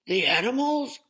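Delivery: high, loud scornful yell; yelling boss. Acted. Circus boss yelling at the captain in disbelief. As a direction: shout it in disbelief. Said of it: "yelled Mister Payne".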